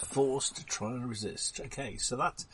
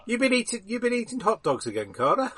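high, teasing scold; 10 semitones higher, much faster than usual. Acted. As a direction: rib your mate about hot dogs. Player teases Carter in character.

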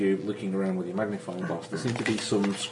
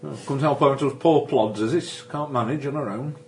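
higher, louder Yorkshire country policeman; a nosy Yorkshire bobby, chummy and higher; Yorkshire constable; 6 semitones higher, 4 dB louder than usual. Acted. GM playing the Yorkshire constable to the travellers, higher and louder. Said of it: "I speak with the Yorkshire accent".

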